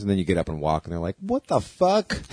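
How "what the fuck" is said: slow, high mock shock; mock shocked onlookers. Onlookers seeing the man walk, slow mock shock.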